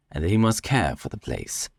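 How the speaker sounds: usual speaking voice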